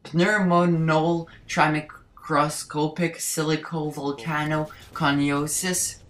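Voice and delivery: slow, piece-by-piece long word; careful long word. Shown as a demonstration. Saying the complicated English word one last time, slowly and carefully. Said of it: "Let me say the English complicated word".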